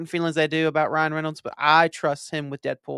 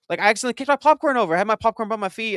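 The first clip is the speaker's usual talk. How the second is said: excited rush; 6 semitones higher, faster than usual. Real, not acted. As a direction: tumble the words out excitedly. Excited retelling.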